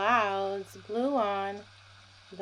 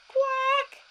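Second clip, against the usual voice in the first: high quacking call; playful duck quack; 5 dB louder than usual. Acted. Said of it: "said Duck".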